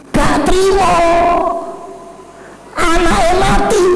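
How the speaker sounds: impassioned sermon climax; fiery preaching. Acted. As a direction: drive the sermon home with fire. Preaching the bad-fate passage.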